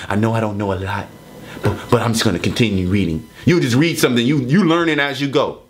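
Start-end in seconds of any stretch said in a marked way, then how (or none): 0.1-3.3 low, lightly voiced mockery; mocking imitation. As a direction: mimic him meekly, lower.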